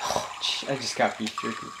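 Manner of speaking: soft breathy fortune teller, half whispered; lightly voiced quiet mystic.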